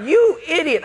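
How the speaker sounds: high-pitched mock outrage; comic exasperation. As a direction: exclaim it at the prankster in exasperation. Acts out anger at flooding prankster.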